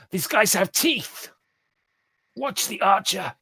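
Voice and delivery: high, loud, drawn-out battle cry; shouted warning. Acted. Game master as wounded enemy warns his comrades, shouting. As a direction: call out slowly across the fight, high and loud. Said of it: "and he shouts".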